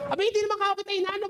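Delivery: very high, very fast mock yell; mock shouting match. Preacher replaying the shouting match with the pastor, high rapid mock yell. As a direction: rattle it off high in a heated mock argument. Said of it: "pinagsisigawan ng pinagsisigawan".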